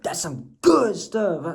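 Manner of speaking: slow, lazy stoner drawl; lightly voiced, slowed-down high guy; a hint of a dazed stoner; mock stoned. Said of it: "I don't mean to be, like, wired".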